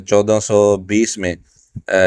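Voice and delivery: ordinary talk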